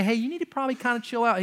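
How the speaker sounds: quick, casual, shrugging advice; mild soft suggestion. Pretend. God as he doesn't speak, quick casual shrug. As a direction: toss it off lightly. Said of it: "He doesn't say".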